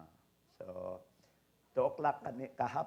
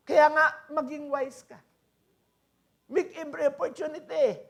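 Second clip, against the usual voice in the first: raised preaching voice; 12 dB louder than usual. Acted. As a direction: exhort the listeners.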